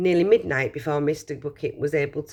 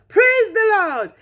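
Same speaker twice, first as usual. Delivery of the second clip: high, loud, slow drawn-out cry of joy; joyful yell; 8 dB louder, slower than usual. Acted. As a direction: stretch it out like a jubilant old man. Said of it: "yelled Grandpa Joe".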